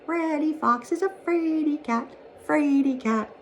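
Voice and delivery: very high, small singsong voice; little breezes' shouted taunt. A narrator's reading. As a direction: chant it in a tiny, teasing treble. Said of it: "playing on the Green Meadows shouted".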